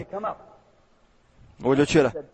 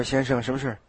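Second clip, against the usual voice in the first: hushed; 4 semitones lower, 8 dB quieter than usual. Retold or imitated. Neighbour answers hushed question.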